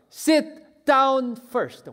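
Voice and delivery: Sit down first barked loud and high, drawn out slowly; booming stretched English command. Acted. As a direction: hammer out each word as an order. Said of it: "예수님이 우리에게 말씀하세요".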